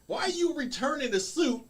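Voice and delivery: rising, laughing rant at a dumb thief; laughing disbelief. Retold or imitated. Lawyer mocking his client's stupid scheme, laughing and rising. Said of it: "It's like".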